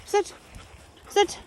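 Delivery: high, loud sit command. Real, not acted. Trainer giving Bernie a loud sit cue.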